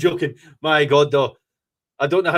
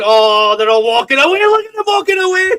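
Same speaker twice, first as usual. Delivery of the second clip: much higher, louder jeering; gleeful mock; 13 semitones higher, 6 dB louder than usual. Real, not acted. Fan jeering as rival supporters leave early, high squeal. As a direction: rise into a squeal of glee. Mocking rival fans leaving.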